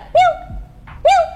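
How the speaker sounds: possessed cat meowing. Pretend. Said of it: "Coba ya".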